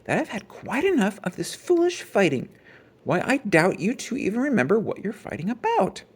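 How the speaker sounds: high, firm mother's scolding; a touch of stern mother; sternly. A narrator's reading. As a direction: lay down the law to the family. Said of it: "said Mama sternly".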